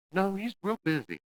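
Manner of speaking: high, slow, falsely breezy excuse; defensive girlfriend. Retold or imitated. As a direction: brush off the question too brightly. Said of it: "your friends and family go".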